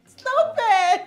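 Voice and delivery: put-on giggly drunk protest; laughing drunk. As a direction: laugh through it, tipsy.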